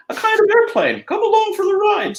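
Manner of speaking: squeaky friendly cartoon mouse; Mickey Mouse falsetto. Mickey voice again.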